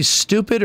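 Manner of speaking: STUPID as stoo-pid, no y sound; stupid with a plain oo. Shown as a demonstration. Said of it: "Well, I do a Liquid You".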